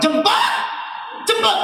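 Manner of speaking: high, sharp command; wife ordering husband to fetch child. Retold or imitated. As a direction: order him out.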